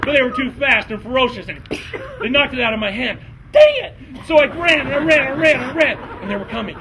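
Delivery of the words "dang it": high loud exasperated cry; frustrated outburst. Acted. Jason losing his knife, high loud outburst. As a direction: blurt it in comic frustration.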